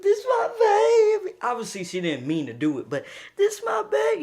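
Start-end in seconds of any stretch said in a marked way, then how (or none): 0.0-1.4 high, loud, sobbing cry; crying shout. Retold or imitated. Mom crying on his shoulder. 3.4-4.2 crying. Retold or imitated. Mom crying again.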